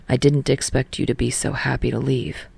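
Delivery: Tsukishima voice. Acted. Tsukishima's voice, deeper.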